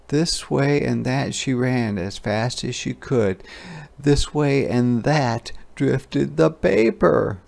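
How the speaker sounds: ordinary delivery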